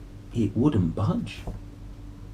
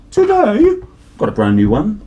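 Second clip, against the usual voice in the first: excited announcement; 11 semitones higher, 9 dB louder than usual. Real, not acted. Announcing the new book.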